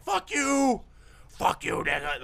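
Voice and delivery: high strained yell, easing off; mock furious yell. Restrained son cursing back at onlookers, mock furious yell. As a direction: yell it like someone pinned and raging.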